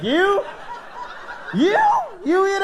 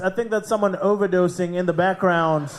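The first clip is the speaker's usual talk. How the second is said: normal talking voice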